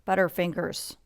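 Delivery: plain reading voice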